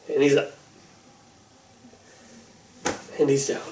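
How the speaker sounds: flat, unimpressed, fading away; bored commentary. Pretend.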